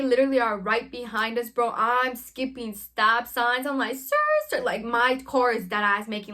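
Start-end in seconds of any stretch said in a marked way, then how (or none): 4.0-4.6 high-pitched skrrt, skrrt; imitated tyre screech. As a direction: mimic tyres screeching round a corner. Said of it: "like, the skirt noises".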